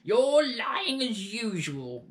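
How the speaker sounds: slow, high, loud hag accusation; sneering crone yell. Acted. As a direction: drag out the accusation. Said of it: "Grandma yelled".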